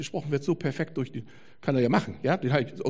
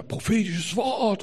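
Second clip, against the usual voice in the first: mock prophetic announcement; 7 semitones higher, 3 dB louder, slower than usual. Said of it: "Und dann schrieben da".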